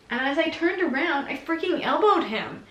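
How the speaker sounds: ordinary talk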